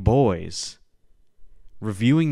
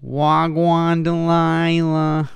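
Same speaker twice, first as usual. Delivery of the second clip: slow, louder drawn-out mock patois; louder, slow mock Jamaican greeting; mock Jamaican slang; 4 semitones higher, 5 dB louder, much slower than usual. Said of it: "this accent road before".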